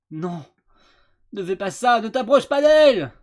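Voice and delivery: loud, high pleading shout; desperate hero cry. Acted. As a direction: stop him, desperately. Voicing hero protest.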